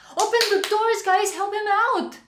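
loud excited call to the screen. Real, not acted. Fan urging the heroes to open the doors, loud and excited.

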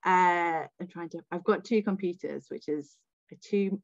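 ordinary talk, nothing marked

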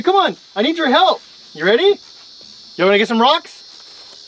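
usual speaking voice

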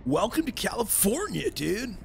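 swooping, quiet Valley surfer accent. Shown as a demonstration. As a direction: greet them like a California surfer. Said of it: "Just surfer voice".

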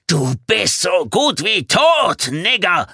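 high, loud, drawn-out threatening shout; furious roar. A narrator's reading. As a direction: roar it slowly, full of rage. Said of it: "brüllte er".

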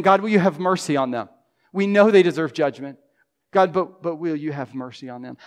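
pleading prayer. Pretend. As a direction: urge God, then soften. Voices an intercessory prayer.

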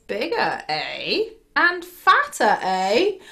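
lightly voiced troll, musing; intrigued troll. Troll tempted by a bigger goat, lightly played intrigue.

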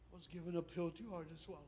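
hushed, grieving confession; somber lament. Acted. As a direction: let it fall softly, subdued. Somber echo of earlier cry.

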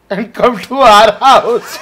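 laughing through the line, slowed. Real, not acted. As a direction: crack up mid-sentence. Laughs while inviting.

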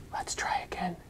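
soft reassuring whisper. Acted. Elephant whispering comfort to the snake. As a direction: breathe it gently.

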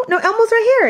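high playful voice. Real, not acted. Playful reply to toddler.

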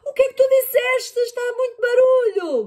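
high, loud yell over the noise; high, loud shouting over din. Acted. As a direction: shout to be heard over the racket. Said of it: "gritou a mãe".

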